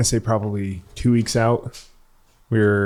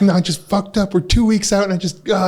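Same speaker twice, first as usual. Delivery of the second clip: pitched well up, distressed and self-blaming; replaying the moment he blew it; high upset retelling; 9 semitones higher than usual. To his wife after the late snack, high distressed reply.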